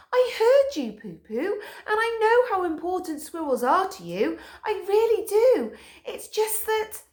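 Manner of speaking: high patient Stick Dog. A narrator's reading. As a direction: patiently soothe an obsessed friend.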